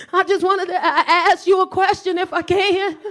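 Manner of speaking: high, hesitant lead-in; tentative. Acted. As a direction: stall timidly before a big question. Timid approach to boyfriend.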